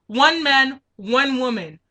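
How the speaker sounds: ordinary delivery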